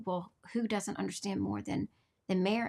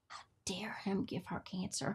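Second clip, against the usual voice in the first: normal talking voice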